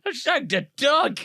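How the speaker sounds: high laughing outburst; laughing shout. Real, not acted. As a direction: shriek it out through laughter. Sudden realisation, laughing.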